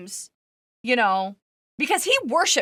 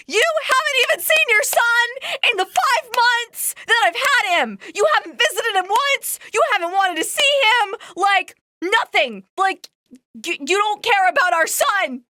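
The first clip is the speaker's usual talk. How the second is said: higher, louder furious accusation; livid retold quote; 8 semitones higher, 3 dB louder than usual. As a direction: hurl it at him, fed up and livid. Wife confronts husband over son.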